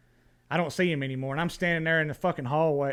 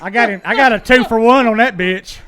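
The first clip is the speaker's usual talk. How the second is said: loud, higher, gleeful brag; excited boast; 7 semitones higher, 12 dB louder than usual. Real, not acted. Guest bragging to the host about the two-for-one shot, loud and gleeful. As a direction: crow about the lucky shot.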